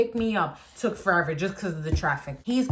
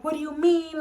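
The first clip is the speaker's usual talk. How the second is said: boss flipping out; 8 semitones higher than usual. Retold or imitated. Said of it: "like, flipping out".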